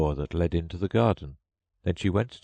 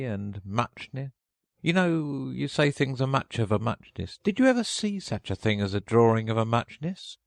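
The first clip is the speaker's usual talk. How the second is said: lightly voiced sleepy Dormouse; 7 semitones higher than usual. A narrator's reading. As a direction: ramble on, just woken.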